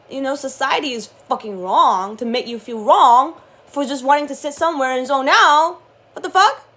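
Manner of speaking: loud, heated rant; angry outburst at society; getting mad. Real, not acted. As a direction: let the anger spill over. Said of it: "I thought I wasn't gonna get mad today".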